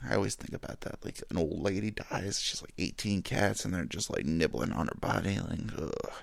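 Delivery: softly, almost under the breath; hushed grim aside. Real, not acted. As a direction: murmur it like a creepy secret.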